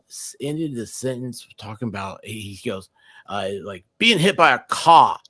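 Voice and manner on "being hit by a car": lightly imitating a Massachusetts accent, a bit louder; quoting a friend with a hint of Boston accent. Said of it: "he did it spot on Massachusetts".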